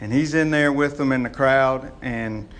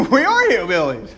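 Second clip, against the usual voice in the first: laughing reply; 5 semitones higher than usual. Retold or imitated. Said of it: "I said".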